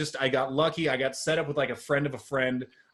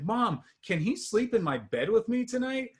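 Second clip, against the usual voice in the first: put-on high, sweet child asking; little kid voice; 8 semitones higher than usual. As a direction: ask like an innocent little boy. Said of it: "he's like".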